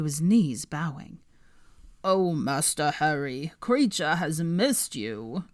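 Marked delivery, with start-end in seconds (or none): 2.0-5.4 put-on slow, croaking old elf voice; old house-elf croaking slowly to his master. A narrator's reading. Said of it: "he croaked".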